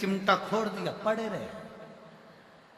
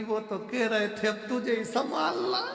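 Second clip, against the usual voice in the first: mock crying; 6 semitones higher, 4 dB quieter than usual. A narrator's reading. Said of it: "पत्नी रोती रोती बोली".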